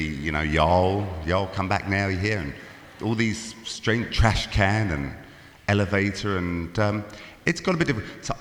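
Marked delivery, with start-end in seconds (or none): 0.0-2.5 a Londoner's slow, lower attempt at a Southern US accent; mock Southern drawl. Englishman teasing the crowd with a slow mock Southern drawl. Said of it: "I've been around some pretty interesting accents recently".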